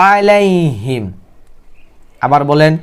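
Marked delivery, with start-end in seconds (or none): 0.1-1.0 "আলাইহিম" starting on a hard squeezed throat sound; strong ayn. Shown as a demonstration. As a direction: press the first a of "আলাইহিম" from mid-throat. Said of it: "শক্ত করে উচ্চারণ করতে হবে".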